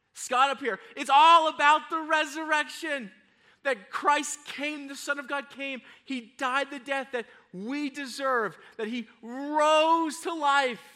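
high, forceful sermon proclamation; ringing, emphatic preacher's delivery; impassioned preaching. Real, not acted. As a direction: proclaim the resurrection with rising fervor.

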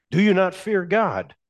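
"you" lifted and leaned on; emphatic preaching. Shown as a demonstration. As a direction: challenge the congregation with "you". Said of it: "preacher repeats with force".

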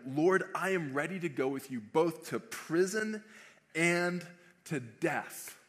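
confident pledge building to a drawn-out finish. Retold or imitated. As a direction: declare it with full confidence. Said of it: "a very confident response".

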